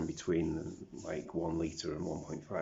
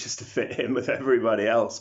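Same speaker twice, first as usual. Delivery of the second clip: loud, raised-pitch venting; exasperated outburst; 6 semitones higher, 14 dB louder than usual. Real, not acted. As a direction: push it out loud and higher. Mocking fitting in with others.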